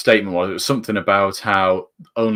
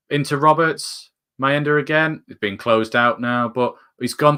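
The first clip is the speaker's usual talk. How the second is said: rising, louder match commentary that builds as the attack develops; live play-by-play; 4 semitones higher, 4 dB louder than usual. Acted. Fan calling the Sunderland game live, building excitement. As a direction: call the move like a TV commentator, getting higher as it goes.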